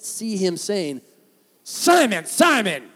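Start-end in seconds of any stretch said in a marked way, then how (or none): 1.6-2.8 high, loud shout of SIMON, falling each time; harsh scolding demo. Shown as a demonstration. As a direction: yell "Simon, Simon" as if telling him off. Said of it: "it just doesn't sound right".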